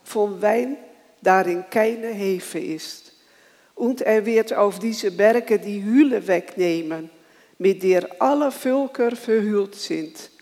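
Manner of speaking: ordinary talk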